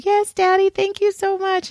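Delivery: put-on high-pitched, sugary daddy's girl; mock-sweet high girlish thanks; grateful daughter. Voices a grateful daughter to dad.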